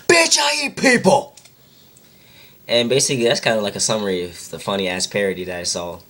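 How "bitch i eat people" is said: high, slow, drawn-out yell; mock outraged comeback. Vampire topping an insult in a quarrel, mock outraged yell. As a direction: screech each word as a hammed-up boast.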